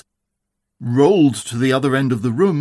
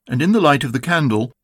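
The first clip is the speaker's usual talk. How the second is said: ordinary delivery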